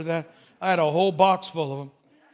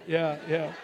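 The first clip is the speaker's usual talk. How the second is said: ordinary talk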